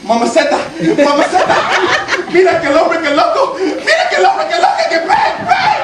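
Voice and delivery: Spanish chatter rising into high, fast falsetto; panicked Spanish relatives. Retold or imitated. Relatives react to the man with a gun, shrill Spanish panic. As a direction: mimic a Spanish panic, climbing to a squeal.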